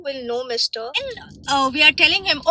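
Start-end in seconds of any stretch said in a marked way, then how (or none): none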